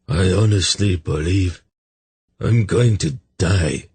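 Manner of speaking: a touch of feeble, queasy misery; mildly weakened, halting between phrases; weak and sick. A narrator's reading. Hungover man sure he is dying, understated weakness. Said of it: "Urgot said weakly".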